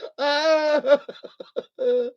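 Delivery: high cackling laugh; real high laughter. Real, not acted. Joker cracking up at own campfire gag, high laughter.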